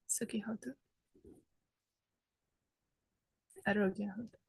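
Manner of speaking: very soft, half-whispered blessing; hushed aside to those arriving; softly murmured blessing. Real, not acted. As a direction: murmur it gently under your breath.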